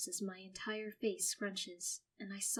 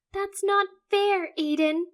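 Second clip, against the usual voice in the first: angry snap; 12 semitones higher, 13 dB louder than usual. Acted. Sister snapping at her brother, angry. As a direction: snap it back at him, hurt and cross. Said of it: "Phoebe angrily snaps".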